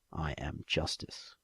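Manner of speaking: soft, low, slow resolve; quiet vow. Acted. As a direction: whisper it to yourself. Final thought before sleep.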